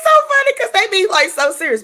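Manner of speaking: high breaking laugh-talk. Real, not acted. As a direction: crack up mid-sentence. Cracking up at the story.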